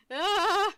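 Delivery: loud, high cry of "ah"; disgusted yelp. Real, not acted. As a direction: yelp at something creepy. Recoils at creepy belly button.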